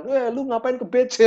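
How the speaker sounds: high, slowed mocking jeer; mocking laugh. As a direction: tease the learner's slip. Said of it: "orang ketawa". (ejekan tinggi dan pelan)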